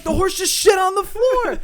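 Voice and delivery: high, loud hammed-up disbelief; mock shocked outcry. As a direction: cry out like an appalled onlooker. Mimics bar crowd's shocked reaction.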